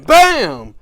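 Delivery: a sudden punched shout of BAM; loud bam. Retold or imitated. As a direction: slam the word out like a blow. Recapping a hard hit.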